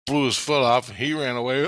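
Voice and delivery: ordinary delivery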